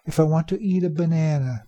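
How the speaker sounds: ordinary delivery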